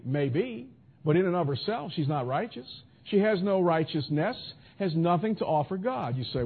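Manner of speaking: ordinary talk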